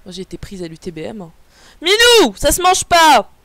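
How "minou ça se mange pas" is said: loud, high call to a pet; scolding shout. Real, not acted. Owner to cat eating something, high scolding shout. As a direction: yell to stop the cat chewing.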